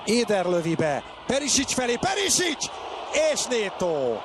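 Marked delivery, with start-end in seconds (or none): 1.3-2.7 high shouted name; excited shout. Real, not acted. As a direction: yell the name out. Chance in play-by-play.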